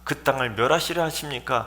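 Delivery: high challenge falling at the end; arguing appeal. Acted. Imagined Abraham arguing for Lot, high insistent protest. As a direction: dispute it right down to one person. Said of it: "거기까지 따져야 되지 않겠습니까".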